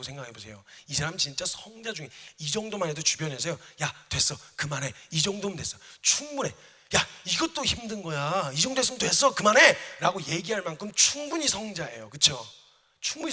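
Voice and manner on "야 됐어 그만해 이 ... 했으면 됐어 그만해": loud insistent urging; mock crowd pleading him to quit; urging friends. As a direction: shout at him to stop, it's enough. Bystanders urging him to stop.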